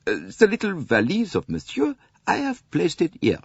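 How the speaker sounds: high, fawning French conductor voice; fawning conductor hinting at a tip, high French-accented voice; obsequious conductor. A narrator's reading. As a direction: angle for a tip, sweetly.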